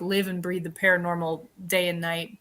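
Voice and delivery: ordinary talk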